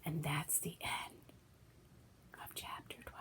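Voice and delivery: soft unvoiced aside; whisper. Real, not acted. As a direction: breathe it as an aside.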